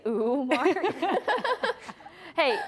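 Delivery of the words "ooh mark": teasing imitation. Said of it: "Did you say".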